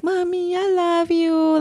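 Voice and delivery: put-on high, slow little-girl voice; sweet child voice. Mom imitating her daughter's sweet high I love you. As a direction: coo it like a loving toddler. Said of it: "And Carissa is like".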